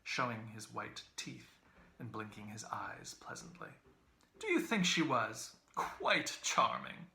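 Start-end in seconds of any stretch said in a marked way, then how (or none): 4.4-7.1 sweet, fussy, slow on charming; finical, sweet. A narrator's reading. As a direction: linger daintily over charming. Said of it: "finical, sweet voice".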